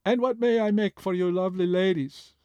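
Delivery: chirpy Greek food truck chef; bright chirp with a light Greek accent. A narrator's reading. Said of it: "In her heavy Greek accent, she chirped".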